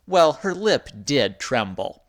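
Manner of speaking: ordinary delivery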